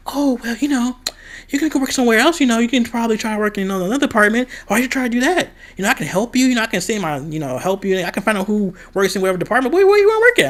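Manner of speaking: high, mocking imitation of her boss; mocking the manager. Imitating her manager coaxing her not to quit, high and mocking. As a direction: pitch it high and mock her pushy offers.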